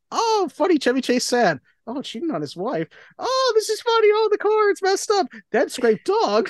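high, sing-song running commentary, mock-dramatic; mock swings of feeling. Mocking own reactions to the movie, high sing-song. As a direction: lilt up high on each "oh" like a swinging graph.

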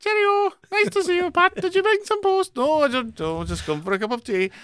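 a cheery Yorkshire farmer greeting the postman; Yorkshire accent. Pretend. Playing Postman Pat's neighbours in a hammed-up Yorkshire accent. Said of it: "For a man who says he can't do a Yorkshire accent".

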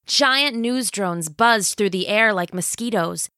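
ordinary delivery

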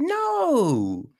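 put-on high, drawn-out childish no; chorus it like small kids; put-on little-kid voice; six-year-olds answering. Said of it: "little fucking six-year-olds all sitting".

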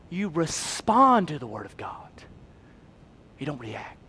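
hushed whisper. Acted. Preacher hushes the point.

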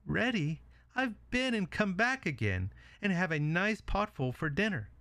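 high-pitched pig voice; triumphant little pig. Acted. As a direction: crow over the wolf in a small high voice. Pig outsmarts the wolf.

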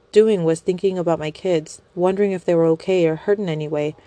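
ordinary delivery, nothing marked

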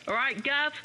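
a light London Cockney greeting; a hint of Cockney, cheeky and casual; Cockney accent. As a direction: attempt a Cockney "All right, Gav?". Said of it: "the London Cockney accent".

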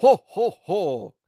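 high, loud hammed-up ho ho ho; Santa laugh. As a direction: boom out a jolly Santa laugh. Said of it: "Babbo dice".

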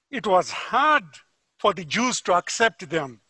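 ordinary talk

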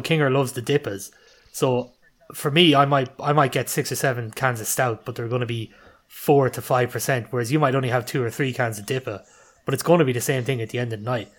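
ordinary talk, nothing marked

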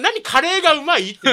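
reads the question out loud, puzzled; baffled reading. Shown as a demonstration. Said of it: "何の質問なんですか". (呆れたように読み上げる)

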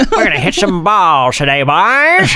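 put-on hearty coach rallying the boys; old-time baseball coach; Sandlot voice. Playing along with Sandlot voice.

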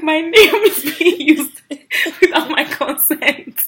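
high, drawn-out laughing protest; indignant complaint played for laughs; mock indignation. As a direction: stretch it out in mock offence. Acts out confused, offended Connor.

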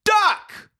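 high, loud "Duck!" shouted as a warning; sharp yelled command. Retold or imitated. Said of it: "I read it as".

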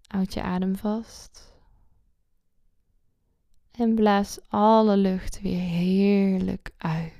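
calm guide with long pauses; guided meditation. Acted.

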